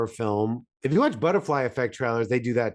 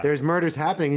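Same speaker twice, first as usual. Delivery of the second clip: usual speaking voice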